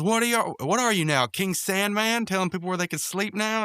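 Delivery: half-acted rant, punching the questions; mock tirade. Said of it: "he goes on, like, this tirade".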